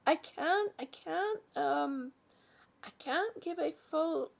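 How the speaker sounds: ordinary delivery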